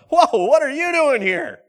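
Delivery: a startled, high, loud "whoa" at a chance meeting; mock surprise. Startled relative abroad, loud and surprised. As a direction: act caught off guard by a familiar face. Running into family in Europe.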